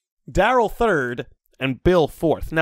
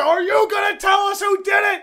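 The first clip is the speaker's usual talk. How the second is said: high-pitched shouted demand, imitating an interrogator; angry shouting; 4 dB louder than usual. Head of security demanding the culprit's name, shouting. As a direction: yell the question as a final demand.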